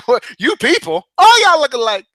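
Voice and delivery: Rush Hour quote. Retold or imitated. Quoting Rush Hour back at Sean, loud and hammed up. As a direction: throw the movie line back, playing it up loud. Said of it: "That's a Rush Hour quote".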